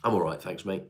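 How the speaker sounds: usual speaking voice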